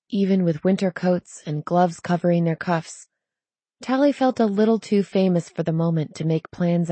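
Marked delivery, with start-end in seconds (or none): none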